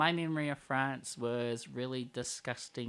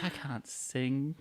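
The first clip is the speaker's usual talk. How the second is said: mock modesty; 5 dB quieter, much slower than usual. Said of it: "I like to be like".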